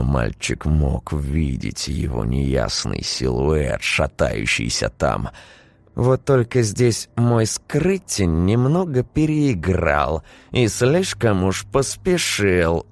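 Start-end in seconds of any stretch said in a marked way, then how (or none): 5.9-12.9 higher, hard steel in the voice; steely. A narrator's reading. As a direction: let cold steel ring through. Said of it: "в голосе няни зазвенела сталь".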